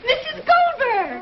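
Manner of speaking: high, slow delighted call. Acted. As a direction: sing out her name in welcome. Calling out to the neighbour.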